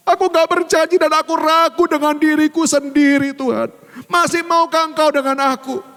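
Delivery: rising, pleading plea to God; pleading prayer. Retold or imitated. (doa memohon dengan nada naik)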